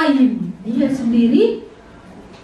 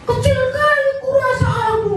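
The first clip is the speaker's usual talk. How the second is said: hurt church member; 11 semitones higher than usual. Retold or imitated.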